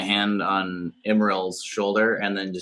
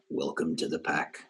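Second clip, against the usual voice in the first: quiet solemn; 4 semitones lower, 9 dB quieter, slower than usual. Acted. Solemn moment welcoming into pack.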